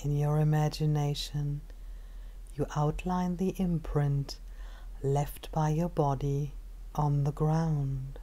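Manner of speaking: calm, unhurried meditation guide with long pauses; measured body-scan narration, phrase by phrase; guided meditation. Acted.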